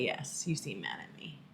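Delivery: hushed, breathy mock whisper; whispered accusation. Wife imitating her own sulky whisper to husband. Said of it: "I would say".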